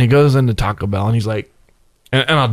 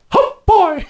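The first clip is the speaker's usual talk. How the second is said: very high squeaky cartoon mouse; a teasing Mickey "oh boy". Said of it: "the two cartoonish voices I picked up".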